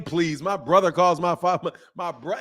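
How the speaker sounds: usual speaking voice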